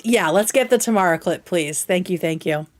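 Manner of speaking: ordinary delivery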